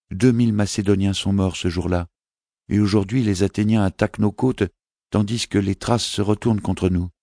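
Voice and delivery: a small prince asking his mother; child's voice, lifted and innocent; young boy voice. Acted. Boy insists on bad news.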